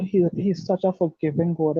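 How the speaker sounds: ordinary delivery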